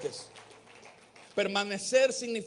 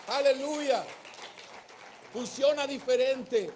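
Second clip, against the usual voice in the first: louder, higher impassioned sermon; full-force pulpit preaching; fervent preaching; 5 semitones higher, 6 dB louder than usual. Acted. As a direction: preach it with rising fire.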